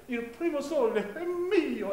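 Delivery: slight catch in the voice on è mio; a touch of sob. Shown as a demonstration. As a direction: sing il primo sole è mio with a small sob. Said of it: "带一点这个口气".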